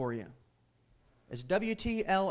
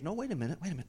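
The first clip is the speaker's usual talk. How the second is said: ordinary talk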